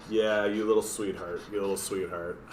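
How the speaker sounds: soft, low doting coo. Real, not acted. Owner dotes on the pug, soft low coo. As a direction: dote on the pug.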